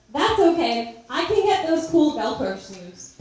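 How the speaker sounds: lightly voiced cheerful little kid; a hint of a carefree child. Quoting her four-year-old self.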